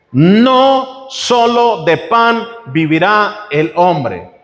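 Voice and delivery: very slow, weighty scripture reading; slow solemn reading. Acted. Preacher reading scripture to the congregation, slow and solemn. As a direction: give every word of the verse its own weight. Quoting Jesus to the devil.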